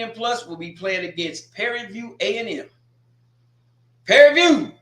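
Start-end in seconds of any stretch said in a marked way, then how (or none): 4.0-4.7 loud, higher call of the team name. Real, not acted. Calling out Prairie View to get it together, loud. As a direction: call them out across the room.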